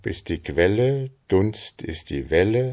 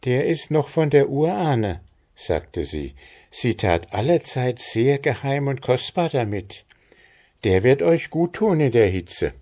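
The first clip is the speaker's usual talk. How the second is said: higher, confiding motherly voice; lightly voiced old mother; 7 semitones higher than usual. A narrator's reading. Mother Stine gives her son the mead flask, lightly voiced. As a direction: hand over a treasured gift.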